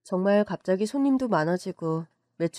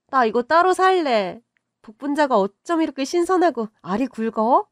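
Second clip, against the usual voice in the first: higher and louder delighted rave; excited raving; 5 semitones higher, 6 dB louder than usual. Acted. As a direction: marvel at the fresh raspberries. Friends rave about the raspberries.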